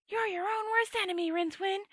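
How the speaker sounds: high, chiding sword voice; a high sword chiding its wizard; high voice of a talking sword. A narrator's reading. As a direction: scold him from up high. The sword scolds Rincewind.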